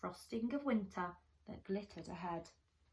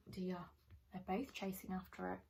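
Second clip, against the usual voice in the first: ordinary delivery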